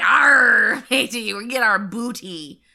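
loud, hammed-up pirate yell; mock pirate cry. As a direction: shout it like a cartoon pirate. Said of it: "be like".

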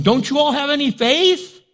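high, loud incredulous challenge; exasperated Jesus. Acted. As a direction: scold the disciples in disbelief. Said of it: "Jesus is going".

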